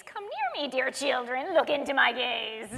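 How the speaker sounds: a hint of a cackling witch. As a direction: lure them in like a witch. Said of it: "cackled a phrase".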